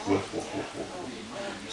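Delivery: soft breathy imitation of puppy woofs; hushed pretend puppy barking; whispered mock bark. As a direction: breathe out little mock barks under your breath. Mimicking a puppy's stress barks.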